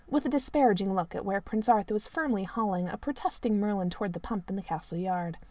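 ordinary delivery

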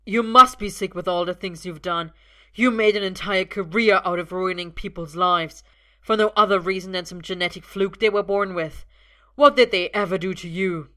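loud accusing shout; loud furious shouting. A narrator's reading. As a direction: shout years of anger at father. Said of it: "Arthur's shouting now".

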